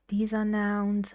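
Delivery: ordinary delivery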